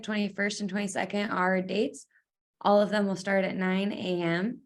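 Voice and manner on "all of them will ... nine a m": normal talking voice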